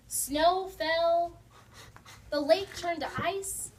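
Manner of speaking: plain reading voice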